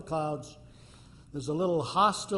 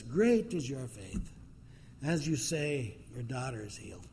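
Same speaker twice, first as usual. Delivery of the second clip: gentle blessing; 4 semitones lower, 5 dB quieter than usual. Retold or imitated. Jesus grants healing.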